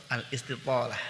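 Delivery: ordinary talk, nothing marked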